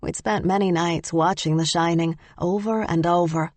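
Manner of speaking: plain reading voice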